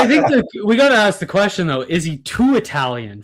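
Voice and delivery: loud, high hammed-up Jersey accent. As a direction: ham up a New Jersey Italian guy. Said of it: "That Jersey accent is beautiful".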